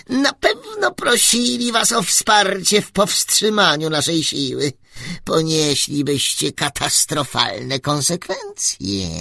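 very high, loud squeak through a megaphone; squeaky little voice. Acted. Tiny ruler greets the travellers through a megaphone, very high squeaky voice.

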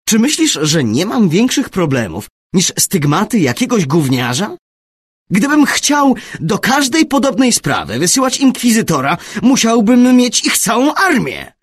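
loud, high-pitched incredulous outburst; astonished. A narrator's reading. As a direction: raise your voice in sheer disbelief. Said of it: "tylko zdumiony". (głośne, pełne zdumienia oburzenie)

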